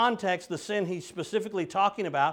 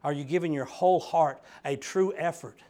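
ordinary talk

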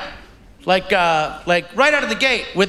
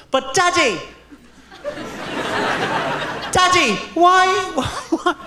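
put-on whiny, higher child voice that sounds like a small British man; lightly voiced little girl pleading; bad impression of daughter; 7 semitones higher than usual. Comic dad imitating his daughter asking about the anthem, whiny plea. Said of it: "That is not a good impression of my daughter".

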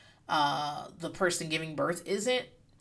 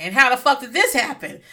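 mock shocked husband; 5 semitones higher, 8 dB louder than usual. Husband in dream.

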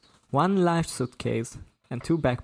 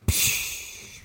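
dismissive "psh" blown out; voiceless puff of dismissal; incredulous scoff; 4 dB quieter than usual. A narrator's reading. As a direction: blow the worry away in disbelief. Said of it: "Luke blew out incredulously".

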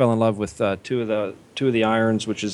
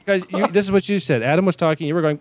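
ordinary talk, nothing marked

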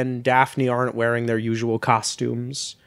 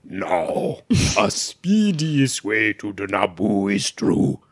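Boss Nass voice; 4 semitones lower, slower than usual. Shown as a demonstration. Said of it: "but it's Boss Nass".

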